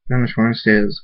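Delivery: ordinary delivery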